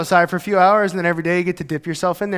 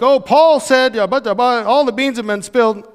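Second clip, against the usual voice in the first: mock reading aloud; 4 semitones higher than usual. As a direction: read it like breaking gossip. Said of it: "they're reading this letter like".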